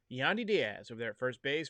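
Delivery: ordinary talk, nothing marked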